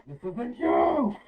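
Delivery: high, slow shouted plea. Acted. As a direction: yell it slowly to reach him. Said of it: "then you hear him say this".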